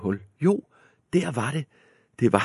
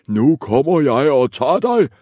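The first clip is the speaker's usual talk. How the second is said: loud, high troll scream; troll screaming at the middle goat; 6 semitones higher, 7 dB louder than usual. Acted. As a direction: scream the threat. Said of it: "skreg trolden".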